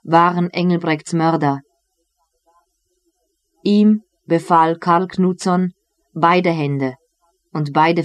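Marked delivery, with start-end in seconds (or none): none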